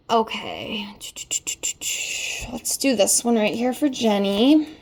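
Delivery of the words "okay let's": whispering. Real, not acted. Whispered okay while shuffling.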